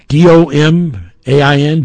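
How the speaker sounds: domain spelled letter by letter as D O M A I N. Shown as a demonstration. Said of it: "the word domain".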